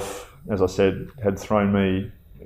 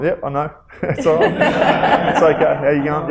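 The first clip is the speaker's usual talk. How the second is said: higher, amused, friendly; good-natured reply; 7 semitones higher, 4 dB louder than usual. Retold or imitated. Soldier answering a fan, high and amused. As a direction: laugh it off kindly. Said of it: "I'm like".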